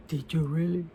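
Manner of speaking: soft, low mock huff; half-hearted huffy try after a sigh. Shown as a demonstration. Mother showing child what a huffy voice is, huffy. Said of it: "what's a huffy voice?".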